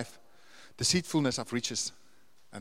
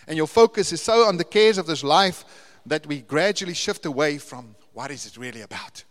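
ordinary delivery

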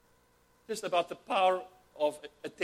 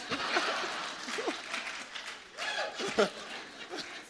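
long airy laugh, high and wheezing; high breathless laugh; 14 semitones higher, 3 dB quieter than usual. Real, not acted. Preacher cracking up at his own joke, high breathless laugh. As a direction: laugh helplessly at the punchline.

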